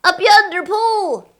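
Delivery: high, loud echo; crew shout. Acted. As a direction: shout it back together. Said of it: "the other shouted".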